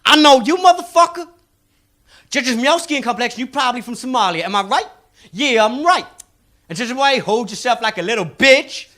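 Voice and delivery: high, cocky street con man; high-pitched con man sizing up a mark; mixtape salesman. Acted. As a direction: read them like a hustling salesman. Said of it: "he took one look at the Somali and he went".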